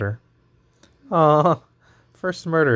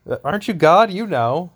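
sassy Cain; 7 semitones higher, 3 dB louder than usual. Acted. Cain voiced sassing God.

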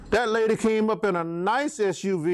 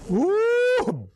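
startled woo; 3 dB louder than usual. Real, not acted. Reacting to being scolded.

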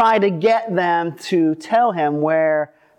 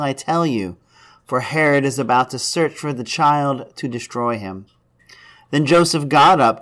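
solemn, measured Gospel reading; scripture reading; 5 semitones lower than usual. Acted. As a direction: read the scripture to the congregation. Reads the Gospel aloud.

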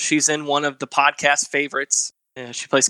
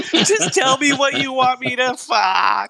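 laughing; 12 semitones higher, slower than usual. Pretend. Quoting absurd scene while laughing.

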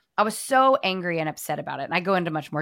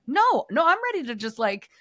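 mock indignant protest; 6 semitones higher than usual. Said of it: "And I was like".

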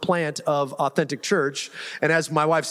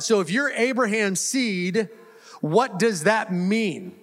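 preaching; 4 semitones higher than usual. Acted. Pastor reads Galatians and calls the church to respond, preaching voice. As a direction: proclaim the verse to the congregation.

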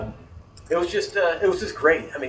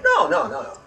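emphatic refusal. Retold or imitated. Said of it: "the other guy's like".

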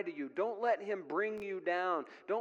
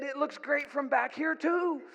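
high, loud devil's quip; raised, loud mock compliment; retold devil's punchline; 7 semitones higher, 5 dB louder than usual. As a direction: call out the punchline as the sly devil. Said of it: "And then he said".

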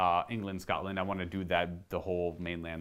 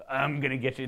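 mock warrior taunt; 4 semitones higher than usual. Fan imitating Geralt's quick stance, a hammed-up taunt. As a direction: play Geralt hamming it up before he lunges. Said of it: "he would just do".

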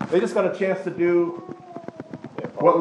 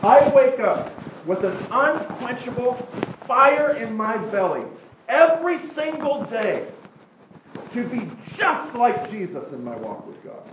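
fervent disciple's vow, pushed higher; fiery commitment; 5 semitones higher than usual. Retold or imitated. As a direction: swear it with fire in your belly. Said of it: "what you're saying is".

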